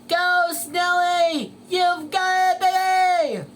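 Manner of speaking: root hard for your racer; loud cheering. Acted. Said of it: "cheered Squidward".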